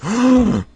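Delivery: high startled gasp; sharp gasp. Acted. Cave girl startled by a stranger, sharp gasp. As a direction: gasp in shock.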